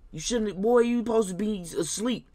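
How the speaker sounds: put-on high, loud scolding at a kid up past bedtime; scolding babysitter. Babysitter scolds the baby found downstairs, high scolding voice. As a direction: tell the boy off like a fed-up sitter.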